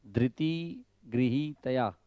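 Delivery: ધૃતિ with a clean ri, no u, slow; slow careful chant, ધૃતિ ગૃહીતયા with plain r; correct dhriti. Shown as a demonstration. Teacher modelling the right sound, ધૃતિ with ri. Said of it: "ખાલી રકાર જ આવવો જોઈએ".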